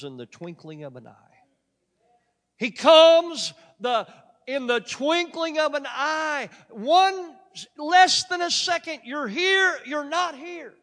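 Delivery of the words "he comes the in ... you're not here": high, loud, slow preaching cry; full-force preaching. Real, not acted. Preacher proclaiming Jesus' sudden return to the church, at full force. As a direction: thunder it out to the congregation.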